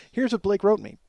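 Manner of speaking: ordinary talk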